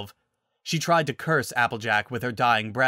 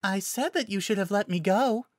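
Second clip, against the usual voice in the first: high, gentle little voice; shy, high-pitched reproach; meek Fluttershy; 9 semitones higher than usual. Acted. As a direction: remind them meekly, high and light.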